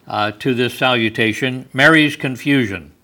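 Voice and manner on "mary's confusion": ordinary delivery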